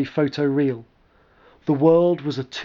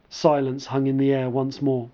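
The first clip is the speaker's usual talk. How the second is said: plain reading voice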